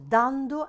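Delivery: "dando" with a strong first syllable; stress on the A. Shown as a demonstration. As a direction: punch DAN in dando. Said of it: "accent sur le A".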